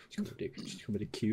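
soft, rushed whimper, hammed-up hurt; mock crying. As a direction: imitate a wounded lover quietly whimpering. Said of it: "and she's crying".

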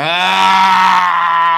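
long, loud sustained "aah"; weird noise. Retold or imitated. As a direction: hold one loud, high "aah" without a break. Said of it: "she makes that noise all the time".

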